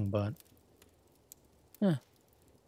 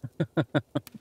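higher, rippling little laugh; amused chuckle; 4 semitones higher, 3 dB louder than usual. Acted. Teased by partner about tonight, an amused chuckle. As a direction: chuckle lightly in a quick run.

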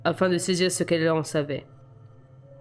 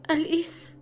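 hoquet de Bella; 11 semitones higher than usual. A narrator's reading. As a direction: gasp the name out in a high hurt voice. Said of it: "hoqueta Bella". (Bella blessée hoquette le nom d'Alice, voix aiguë)

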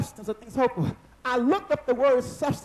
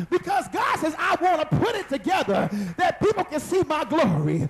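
fiery preaching; 3 semitones higher than usual. Acted. Pastor firing up the congregation, a fiery preach. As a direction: preach it hard to the church.

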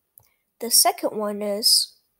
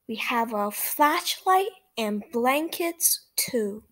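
lively read-aloud for small listeners; reading to children; 5 semitones higher, slower than usual. A narrator's reading. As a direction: read it brightly to little kids. Reads picture book to children.

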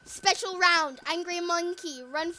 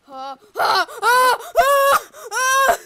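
panicked shout; 5 semitones higher, 9 dB louder, much slower than usual. Real, not acted. Warning dad in game.

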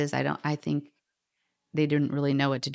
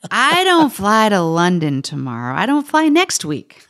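loud, laughing disbelief, higher; 3 semitones higher, 9 dB louder than usual. Retold or imitated. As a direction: toss it off through a laugh, incredulous. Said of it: "And I burst out laughing".

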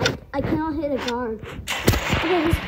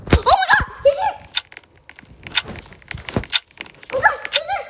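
high panicked squeal; real panic; 12 semitones higher, faster than usual. Real, not acted. Player spotting the tagger coming, very scared. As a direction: squeal in fright as danger closes in. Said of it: "I'm so scared!".

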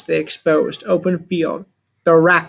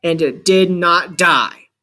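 ordinary delivery, nothing marked

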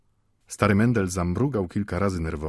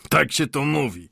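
mildly evasive reply, pitched up; diplomatic; 7 semitones higher, 4 dB louder than usual. A narrator's reading. Watchmaker avoids agreeing with old Mendel, diplomatic. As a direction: brush the claim aside diplomatically. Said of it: "odparł dyplomatycznie zegarmistrz".

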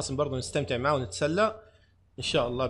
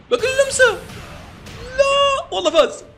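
very high, loud wail of defeat; real despair, high and loud, slowing at the end; anguished shriek; 7 dB louder than usual. Real, not acted. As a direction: scream as the rival lands the winning hit. Opponent wins the round.

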